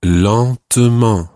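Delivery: slow careful teacher's "lentement"; slow model word. Shown as a demonstration. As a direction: say "lentement" slowly, syllable by syllable. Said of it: "the word for slowly is".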